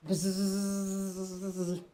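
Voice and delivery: soft drawn-out buzz imitating a fly. Shown as a demonstration. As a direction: buzz softly like a fly hovering near. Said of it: "can you hear me when I say buzz".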